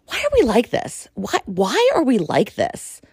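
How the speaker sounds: ordinary talk, nothing marked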